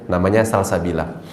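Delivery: normal talking voice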